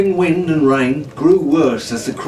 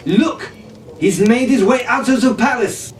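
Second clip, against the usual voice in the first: alarmed; 8 semitones higher than usual. Acted. Jean alerts Jack in storm.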